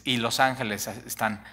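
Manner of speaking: ordinary delivery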